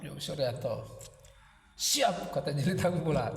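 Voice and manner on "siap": high, short cry; echoed acknowledgement. A narrator's reading. Jelitang echoing Suryanto's reply, high short call. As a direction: throw the reply back, pitched high.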